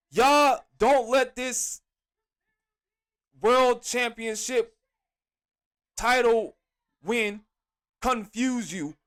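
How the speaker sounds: each word spaced out slowly; slow, emphatic warning. Real, not acted. Host warning his viewers, slow and emphatic. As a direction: lean on every word so it sinks in.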